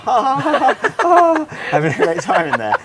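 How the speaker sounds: high, loud put-on laughing; mock merry laughter. Parents faking fun for their son's benefit, exaggerated merriment. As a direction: laugh as if having a great time. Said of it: "make it look like we're having a great time".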